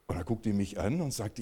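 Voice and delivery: ordinary talk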